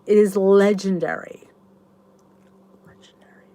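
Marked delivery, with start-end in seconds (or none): 2.8-3.4 whisper. Shown as a demonstration. Repeats the word, then whispers it.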